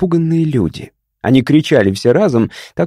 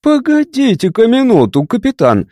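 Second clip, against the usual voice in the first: резко; 9 semitones higher than usual. A narrator's reading. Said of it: "резко оборвал его Заммо".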